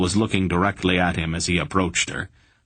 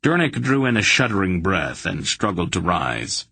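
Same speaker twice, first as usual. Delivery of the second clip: usual speaking voice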